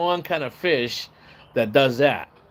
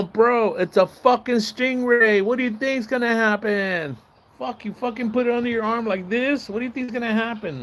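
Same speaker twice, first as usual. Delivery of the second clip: exasperated scolding; 9 semitones higher than usual. Retold or imitated. Scolding the stung friend.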